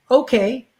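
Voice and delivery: high, resigned "okay"; giving in to seeing Avengers; meek okay. Retold or imitated. High meek okay. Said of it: "And I would say".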